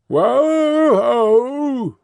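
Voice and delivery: long, high coachman's call to the horses; high drawn-out holler. Acted. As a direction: draw it out high, like halting a team. Coachman calls out to his horses.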